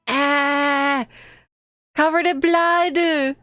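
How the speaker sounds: high, drawn-out cry of horror; alarmed wail. Real, not acted. Player seeing the bloody scene, horrified wail. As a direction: wail it slowly, pitched high.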